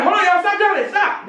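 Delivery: high, urgent call to stop; yelling stop. Retold or imitated. Yelling at partygoers to stop jumping, high and urgent. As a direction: cut through the noise, pitch up.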